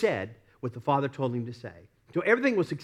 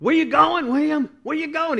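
high, loud mock call after a departing leader; acted-out shout to William Wallace; Braveheart soldiers calling out; 12 semitones higher, 7 dB louder than usual. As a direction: call out urgently in a raised pitch. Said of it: "and they said".

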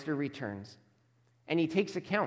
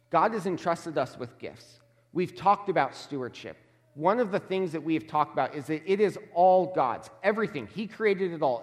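usual speaking voice

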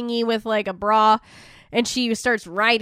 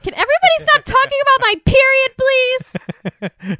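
much higher, louder exasperated plea; fed-up teen whine; 8 dB louder than usual. Retold or imitated. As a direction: daughter fed up with everyone, mock whine. Daughter exasperated.

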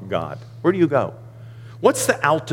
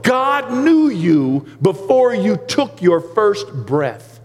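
high, rising pulpit voice, slowing on key lines; full-force preaching; 9 semitones higher, 3 dB louder than usual. Acted. Pastor preaching Psalm 139 to his church, full force. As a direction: preach it out to the congregation.

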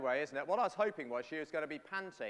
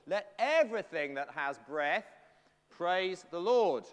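louder and very slow; slow proclamation; 2 semitones higher, 7 dB louder, slower than usual. Acted. Preacher declaring the verse to the children, slow and loud. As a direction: draw out each word as a proclamation.

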